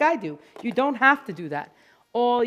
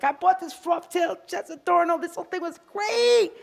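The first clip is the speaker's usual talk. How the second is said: much higher, gushing; excited groomer; 7 semitones higher than usual. Retold or imitated. Groomers quoted raving about the froth tail, high-pitched gushing. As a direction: rave about the product. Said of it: "and you're like".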